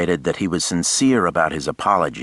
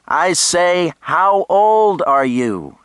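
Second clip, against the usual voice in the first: put-on loud, slow, high shout to a deaf old man; 13 semitones higher, 8 dB louder, slower than usual. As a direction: bellow each word slowly. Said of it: "she shouted, as if talking to a deaf old man".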